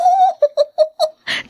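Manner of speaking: high, knowing cackle; teasing laugh. Acted. Neighbour laughing at the mother over the suitor, teasing cackle.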